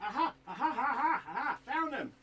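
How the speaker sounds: very loud, high victory whoops; triumphant shout. Real, not acted. Hobbyist finally finds the missing item, triumphant shouting. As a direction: crow your win at full volume. Triumph after long search.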